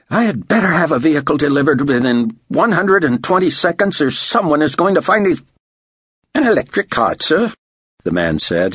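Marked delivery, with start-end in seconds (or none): 0.1-5.4 high-pitched, demanding ultimatum; barked order. A narrator's reading. Director to motor pool supervisor on the phone, barked. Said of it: "finally barked at the motor pool supervisor". 6.3-7.5 high-pitched Aussie query; Aussie accent. A narrator's reading. Aussie motor pool man answering the director, Australian accent. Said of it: "his accent making David guess he was an Aussie".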